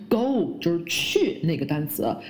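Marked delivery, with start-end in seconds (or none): 0.1-0.6 put-on "go" standing in for girl, no L at all; plain open "go" as a botched girl; students' mistake. Shown as a demonstration. Teacher imitating students' error, L-less "go". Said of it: "很多学生会把女孩girl发成go".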